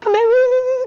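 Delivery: imitating her own wailing; mock crying. Running down the road crying, mock sob. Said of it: "रो रही हूँ".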